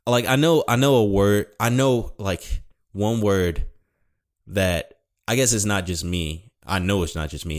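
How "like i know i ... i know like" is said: normal talking voice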